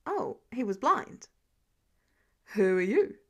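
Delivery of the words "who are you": lower male voice. Acted. Blind lawyer, lower voice.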